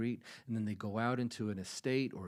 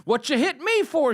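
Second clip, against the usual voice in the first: much higher, loud shocked yelp; indignant outcry; 14 semitones higher, 13 dB louder than usual. Retold or imitated. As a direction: cry out like the wife just got whacked. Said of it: "she cries out".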